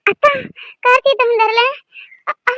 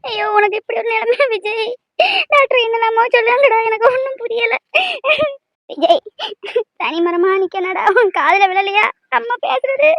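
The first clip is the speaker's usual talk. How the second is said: sobbing lament between pleas; crying. Acted. Mother grieving over her unresponsive son, crying. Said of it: "அழாதம்மா".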